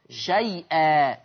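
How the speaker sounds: very slow, low "شيئا", shayyy-aa; lengthened leen. Shown as a demonstration. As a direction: stretch the "ay" of "شيئا" before the hamza. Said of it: "بتوسط وإشباع اللين".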